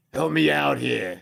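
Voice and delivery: high, slow mock New York whine. Co-host mimicking friend's plea, hammed-up New York accent. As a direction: imitate an irate New Yorker, drawn out.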